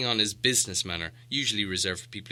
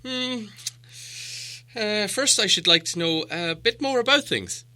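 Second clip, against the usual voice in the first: a lightly voiced hobbit, unsure and higher; hesitant, polite, a little shaky; confused Bilbo; 13 semitones higher than usual. A narrator's reading. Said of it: "feeling all confused and a bit shaky inside".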